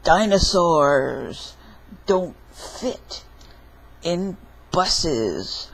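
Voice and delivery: lightly voiced dinosaur, slow and drawn out; pretend dinosaur. Child playing dinosaur on a bus, pretend dinosaur voice. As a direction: announce it slowly as a dinosaur.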